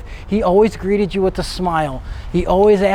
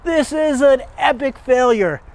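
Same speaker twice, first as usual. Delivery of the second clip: mock outraged rant, pitched much higher; retold yelling; 7 semitones higher than usual. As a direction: imitate the videographer chewing you out, shrill and high. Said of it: "he starts screaming and yelling".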